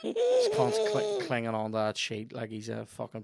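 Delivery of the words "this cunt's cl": high squeal. Real, not acted. Squeal at the screen.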